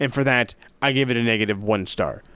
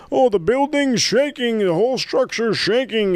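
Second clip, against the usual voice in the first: much higher, hammed-up announcer gasping at the shaking ring; mock Monsoon commentary; 11 semitones higher than usual. Imitating Monsoon calling the stomping ring, high and overblown. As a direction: play the excitable ringside commentator in a high put-on voice. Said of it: "is what Monsoon says".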